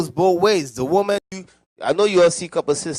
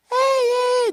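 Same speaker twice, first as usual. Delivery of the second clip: put-on lightly raised, girlish hey; girly voice. Mocking men who talk like a girl, lightly girlish voice. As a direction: mimic a man who talks like a girl. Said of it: "they talk like this".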